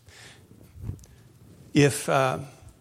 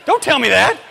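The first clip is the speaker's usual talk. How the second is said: high, loud mock outrage; exasperated shout; 12 dB louder than usual. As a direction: shout it like you're fed up, half joking. Comic outrage at golfers' church claim.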